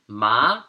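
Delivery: MA gliding upward; second tone. Shown as a demonstration. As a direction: say "ma" loud with the pitch climbing. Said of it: "The second tone".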